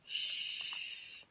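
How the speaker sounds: whispered hush; soft shush. Acted. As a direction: hush gently, don't wake the calf. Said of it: "mooed Clarissa softly".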